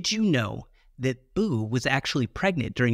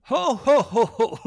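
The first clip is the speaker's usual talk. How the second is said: big jolly Santa ho ho ho; belly-laugh it like Santa Claus; 8 semitones higher, 5 dB louder than usual. Pretend. Santa laugh for holiday episode.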